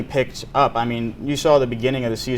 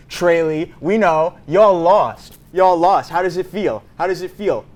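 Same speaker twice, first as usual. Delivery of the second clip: taunting; 5 semitones higher, 5 dB louder than usual. Real, not acted. Taunting rival school fans.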